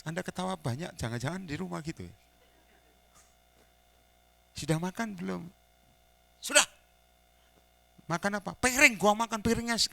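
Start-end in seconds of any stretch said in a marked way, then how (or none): 4.6-9.8 1 bitter retort. Retold or imitated. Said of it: "itu sudah kepahitan".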